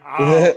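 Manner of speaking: hammed-up wail of a wreck; mock wail. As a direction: act out an overwhelmed cry. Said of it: "I was a wreck".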